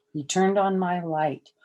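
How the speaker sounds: ordinary delivery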